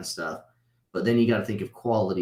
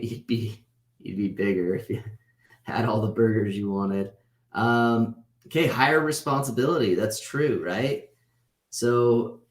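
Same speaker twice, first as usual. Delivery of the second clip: ordinary talk, nothing marked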